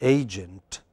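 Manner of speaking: "Agent" with the weight on AY, second syllable weak; stress on first syllable. Shown as a demonstration. Teacher models the word for the class, stressed on first syllable. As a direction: say "Agent" as AY-jent. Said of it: "how do you pronounce it".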